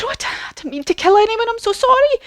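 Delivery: shocked, high, fast apology; high, flustered, hurried pleading; Dorothy. Acted. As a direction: stammer an apology in a high girlish voice. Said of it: "And Dorothy's shocked".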